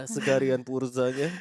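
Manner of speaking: put-on slow Japanese-accented cashier voice; cheerful Japanese AI cashier; chipper text to speech voice. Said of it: "nggak pakai R".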